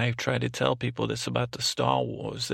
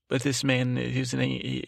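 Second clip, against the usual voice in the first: ordinary talk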